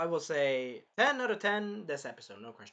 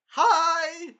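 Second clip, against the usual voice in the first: high, loud, drawn-out call; surprise greeting shout; 12 semitones higher, 12 dB louder than usual. Retold or imitated. Raphtalia popping up behind her enemy, high shout. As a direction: sing it out loudly to startle.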